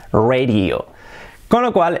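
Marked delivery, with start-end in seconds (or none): 0.1-0.9 "Radio" said as REY-rio; English-style RAY-dee-o; English pronunciation. Shown as a demonstration. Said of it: "se pronuncia".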